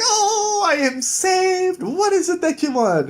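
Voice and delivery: very high, slow, gushing lady voice; overjoyed lady. Retold or imitated. Saved lady gushes thanks to Jack. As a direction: swoon with delighted relief.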